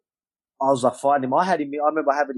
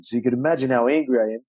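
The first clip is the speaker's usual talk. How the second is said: usual speaking voice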